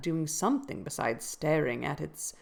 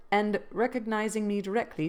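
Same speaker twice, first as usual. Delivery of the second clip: ordinary delivery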